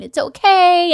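high, cooing reassurance; sweet. Retold or imitated. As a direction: soothe a small child. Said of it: "they'll be so sweet".

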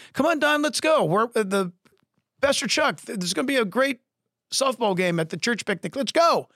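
high-pitched pep talk to a friend; eager coaxing. Retold or imitated. Friends inviting Don to church softball, acted eager coaxing. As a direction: urge him along, acting out an upbeat pal.

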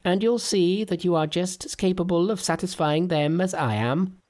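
very high, dismissive Monkey voice; Monkey waves off Sandy's worry, very high; Monkey whispering. Acted. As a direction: brush it off like a cheeky monkey. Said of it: "whispered Monkey".

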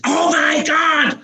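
as if someone just died; alarmed shout. Retold or imitated. How his traumatised brain hears his wife, a panicked drawn-out scream. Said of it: "What I hear is".

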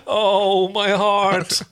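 high, loud theatrical "oh"; hammed-up heartache; mock swooning. Co-reader teasing the romantic confession, mock swooning.

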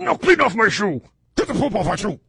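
high, loud bossy order; shrill demanding shout. Acted. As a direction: demand it loudly. Acting the fiancée.

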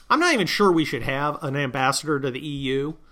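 ordinary delivery

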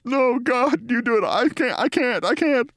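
mortified high-pitched refusal; Bill Maher. Retold or imitated. Embarrassed colleague begging off explaining, high mock-anguished mumbling. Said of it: "shakes his body no and mumbles".

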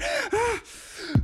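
sharp gasp. Acted. Terror peak before confession.

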